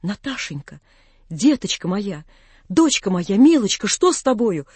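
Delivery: ordinary delivery, nothing marked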